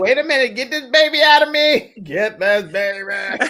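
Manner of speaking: loud, high comic outburst; playful, urgent mock yell; mock frantic mother. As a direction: holler it high like a woman in labor, hammed up. Acting the mother giving birth.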